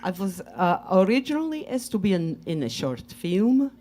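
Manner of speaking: put-on light Russian accent, broken English. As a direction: tell the story in a Russian accent. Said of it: "do a Russian accent".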